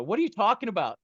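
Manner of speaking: retold bewildered outburst; alarmed disbelief. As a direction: act out a startled protest. Alarmed retort about his feet.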